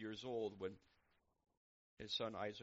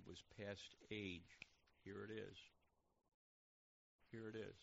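hushed scripture reading; 3 semitones lower, slower than usual. Real, not acted. Reads scripture slowly and softly.

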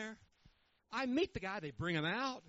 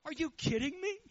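high, louder disbelief; exasperated rhetorical outburst; an indignant, high-pitched are you kidding me; incredulous; 7 semitones higher, 4 dB louder than usual. Real, not acted. Incredulous reaction.

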